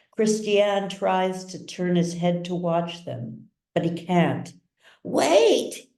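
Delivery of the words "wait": high desperate scream. A narrator's reading. Trapped patient screams after doctors, high and desperate. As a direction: scream to stop them. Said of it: "he screams".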